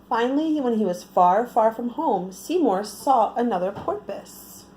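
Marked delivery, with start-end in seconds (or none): none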